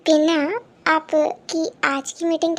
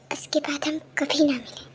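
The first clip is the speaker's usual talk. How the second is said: soft whispered foreboding; ominous whisper; 2 semitones lower, 8 dB quieter than usual. Acted. Dramatic aside to the audience, whispered. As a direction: whisper it like a dark omen.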